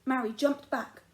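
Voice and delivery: ordinary delivery, nothing marked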